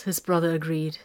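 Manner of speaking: plain reading voice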